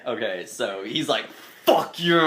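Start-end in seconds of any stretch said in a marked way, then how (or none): 1.7-2.2 okrzyk bojowy, lekko podniesiony głos; higher mock battle cry; super amped. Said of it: "he's over there super amped".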